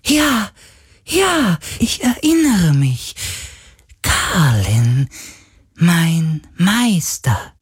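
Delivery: high, eerie spirit voice, slowing on the last words; an ancient sea spirit remembering with awe; ghostly spirit. A narrator's reading. Sea spirit recalls its master Carlin, high eerie voice.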